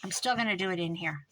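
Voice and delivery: normal talking voice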